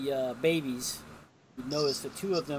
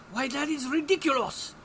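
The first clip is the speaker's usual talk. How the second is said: mock French accent, pitched much higher; 11 semitones higher, 3 dB louder than usual. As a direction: ham up a French policeman with a French accent. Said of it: "And the French guy's going".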